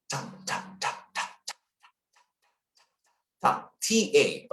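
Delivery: voiceless staccato "ta" bursts; a steady train of light, breath-driven tongue taps; breathing drill. Shown as a demonstration. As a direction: puff out short unvoiced "ta" sounds on the breath. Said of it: "and then do this".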